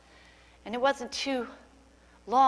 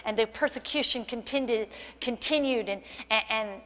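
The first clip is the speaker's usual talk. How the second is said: ordinary talk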